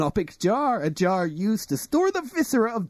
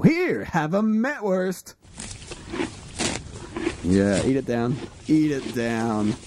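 coaxing an animal; 5 semitones lower than usual. Acted. Feeding the pony.

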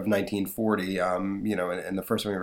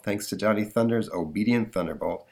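ordinary delivery

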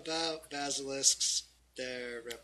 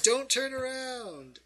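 high, urgent cry that falls at the end; alarmed warning; 9 semitones higher than usual. Acted. Loris warning the fighter about the basilisk, alarmed cry. Loris warns, then you echo it.

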